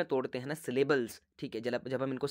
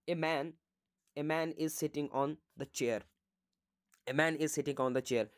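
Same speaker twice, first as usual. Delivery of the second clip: each word spaced out for the class, very slowly; slow example dictation; 3 dB quieter, much slower than usual. Shown as a demonstration. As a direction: say the example slowly twice so it sinks in.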